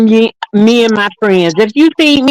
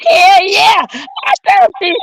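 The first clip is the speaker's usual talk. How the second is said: laughing; 9 semitones higher than usual. Real, not acted. Laughing loud reply.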